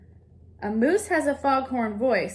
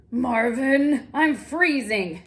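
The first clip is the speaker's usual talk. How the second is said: louder, slow complaining cry; cried-out complaint; 5 dB louder, much slower than usual. Acted. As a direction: wail it out, cold and fed up. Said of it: "Mister Zonker cried".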